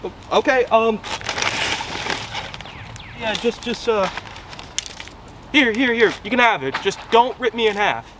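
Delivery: high, stammering nervous voice. Acted. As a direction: stutter it out in fear. Nervously feeding the dinosaur.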